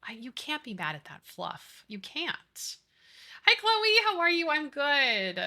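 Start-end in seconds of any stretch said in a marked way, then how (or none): 3.4-5.4 high pet voice. Real, not acted. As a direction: squeal hello like greeting a puppy. Greeting a pet in chat.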